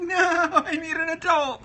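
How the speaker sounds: high, whiny cry for help; mock panicked victim. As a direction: squeal in hammed-up alarm. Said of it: "I'm like".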